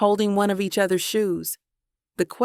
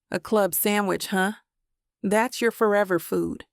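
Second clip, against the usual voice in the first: ordinary delivery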